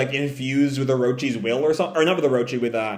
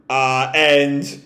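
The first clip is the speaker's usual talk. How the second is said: ordinary delivery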